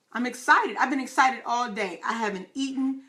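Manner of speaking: ordinary delivery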